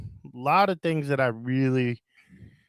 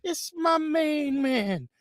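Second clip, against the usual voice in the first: ringmaster; 6 semitones higher than usual. Acted. Announcer intro of hosts.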